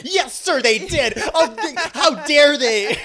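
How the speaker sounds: high, loud snitching goody-two-shoes; high, loud mock tattle to the cop; tattletale. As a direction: tell on them to the cop, prissy. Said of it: "And I was like".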